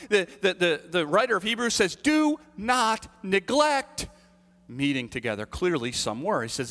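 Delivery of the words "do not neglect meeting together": very slow, stretched Do not neglect; preacher drives the command home to the congregation; emphatic command. Acted. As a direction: lean hard on Do not neglect.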